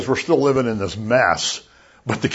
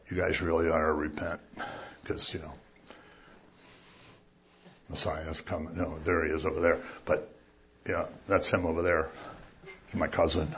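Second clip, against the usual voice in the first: much softer, low and meek; mock timid murmur; 6 semitones lower, 12 dB quieter than usual. Preacher mocking a meek John who murmurs instead of shouting. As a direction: mumble it as if nobody should hear. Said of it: "It's not a quiet little whispering voice".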